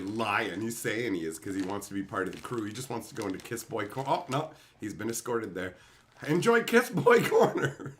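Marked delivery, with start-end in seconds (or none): 6.3-7.9 slow, laughing gloat; laughing taunt. Real, not acted. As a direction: savour the jab through laughter. Mocking banished chatters.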